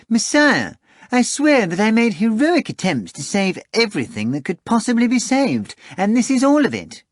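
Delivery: high, loud wheedling cat voice; a fat cat bragging and wheedling; boastful cat Behemoth. Acted. Behemoth swearing to Voland, high wheedling cat voice. Cat voice pleads his case.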